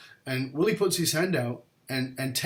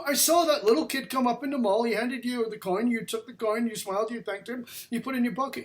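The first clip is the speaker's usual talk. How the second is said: much higher, insistent listing; agitated high accusation; 9 semitones higher than usual. Retold or imitated. Young man lists what the bishop did, agitated and high. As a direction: pile up the proof, incredulous. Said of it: "I go".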